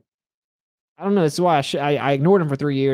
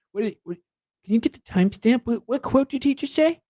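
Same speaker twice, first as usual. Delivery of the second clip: much higher, mock-flustered questioning; mocking impression of debate watcher; 9 semitones higher than usual. Streamer mocking professor asking for a timestamp, high and flustered. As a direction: imitate a flustered note-taker, pitch climbing high. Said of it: "watching my debate, being like".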